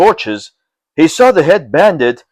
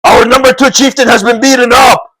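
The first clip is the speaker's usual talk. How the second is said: high, louder panicked report; alarmed shout; 9 semitones higher, 4 dB louder than usual. Acted. Brigands telling their leader of the beating, shouting. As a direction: yell the bad news.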